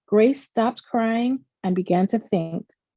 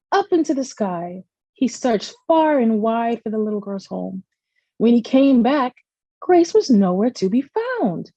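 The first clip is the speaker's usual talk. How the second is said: usual speaking voice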